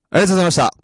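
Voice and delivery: 元気な接客の声で「ありがとうございました」; shop thank-you. Shown as a demonstration. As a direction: send the customer off with a big voice. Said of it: "お客さん帰る時？".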